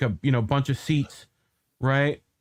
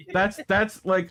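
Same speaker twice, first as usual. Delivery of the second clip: ordinary talk, nothing marked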